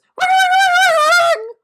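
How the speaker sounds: loud high bell noise imitation; imitated loud bell. Shown as a demonstration. Vlogger imitating the overly loud bell, loud high shriek. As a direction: blast it out like a too-loud bell sound effect. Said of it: "And the bell's like this".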